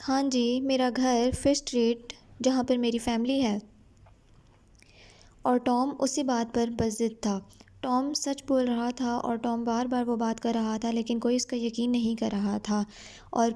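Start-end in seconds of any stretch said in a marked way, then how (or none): none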